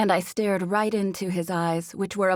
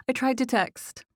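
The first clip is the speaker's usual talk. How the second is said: higher-pitched apologetic excuse; 7 semitones higher than usual. A narrator's reading. Roommate excusing her interruption, apologetic. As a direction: explain yourself apologetically, voice raised in pitch. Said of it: "with an apologetic expression".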